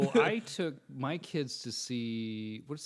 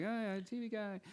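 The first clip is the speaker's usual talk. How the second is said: high mock-humble; 9 semitones higher, 6 dB quieter than usual. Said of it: "I was like".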